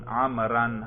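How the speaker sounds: عمرن said as amran, ending in a nasal -an; accusative -an ending. Shown as a demonstration. Teacher lists the case endings, the -an form second. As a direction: say عمرن with a clear -an at the end. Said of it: "تینوں اعراب بھی آتے ہیں".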